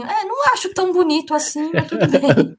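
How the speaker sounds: high, laughing student voice; mocking bored students. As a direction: mimic an unimpressed student, laughing. Voicing unimpressed students.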